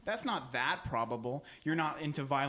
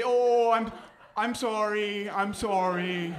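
loud, drawn-out mock-meek sorry; non-threatening apology; 4 semitones higher, 7 dB louder, slower than usual. Cowering trespasser tries to calm an angry resident, apologetic. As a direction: placate them with slow, dopey apologies. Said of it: "I'm, like, kinda non-threatening".

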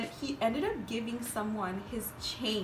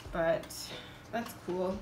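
ordinary delivery

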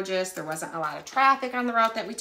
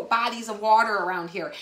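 ordinary delivery

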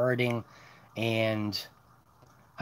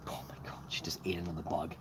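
unvoiced, under-the-breath exclamation; whispered disbelieving aside; muttered aside; 7 semitones lower, 12 dB quieter, faster than usual. Real, not acted. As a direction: mutter it to yourself, amused. Dog eats a bug.

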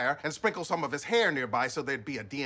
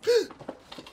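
sharp gasp; 4 dB louder than usual. Acted. Startled by fax.

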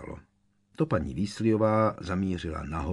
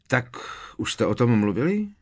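higher-pitched, eager asking; keen, raised-pitch question; 5 semitones higher, 4 dB louder than usual. A narrator's reading. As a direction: jump in eagerly, wanting to know. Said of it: "spustil George dychtivě".

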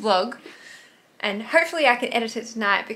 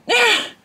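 high, loud giddy giggle; giddy laugh; 12 semitones higher, 10 dB louder than usual. Real, not acted. Gushing to viewers about her gym crush, a loud giddy giggle. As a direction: burst out giggling.

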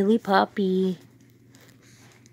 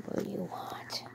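soft unvoiced whisper; 19 dB quieter than usual. Real, not acted. Owner whispering a question to her puppy. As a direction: breathe it secretly, no voice. Whispers to dog, then repeats.

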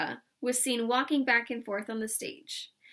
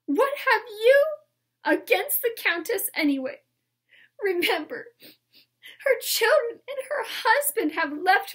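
high, loud, hammed-up crying; tearful accusation building to a wail; sobbing; 12 semitones higher, 8 dB louder than usual. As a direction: bawl it out, breaking up toward the end. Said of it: "she sobbed".

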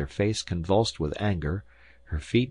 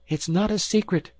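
high-pitched indignant cry; cried out; 13 semitones higher, 3 dB louder than usual. A narrator's reading. Wife protesting to her husband, high indignant cry. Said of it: "she cried".

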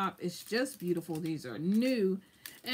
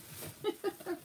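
soft laugh; 12 semitones higher, 7 dB quieter than usual. Real, not acted. Laughing at her own life.

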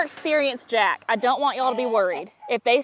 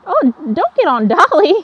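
louder, stopping him mounting Dolly; loud warning; 5 semitones higher, 9 dB louder than usual. Real, not acted. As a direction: call out loud and sharp to the donkey.

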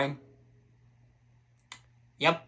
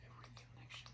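breathy whispered title; hushed title whisper; 35 dB quieter, much faster than usual. Acted. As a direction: whisper it like a secret. Whispered title before video.

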